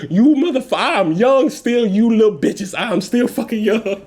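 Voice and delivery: high, drawn-out mock outrage; indignant protest. Acted protest at age guesses.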